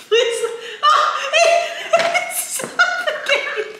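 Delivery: very high, laughing and pleading; laughing disgust. Real, not acted. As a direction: beg through disgusted laughter. Stink bug bean, gagging.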